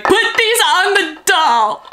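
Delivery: high, loud, slow mock order; mock demanding. Telling her son on waking, a hammed-up high demand. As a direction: bark it like a spoiled demand. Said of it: "I'm gonna be like".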